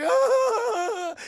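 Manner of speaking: high, loud crybaby wail; mock wail. Son seeing his possessed mother, hammed-up wail. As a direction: bawl in exaggerated terror.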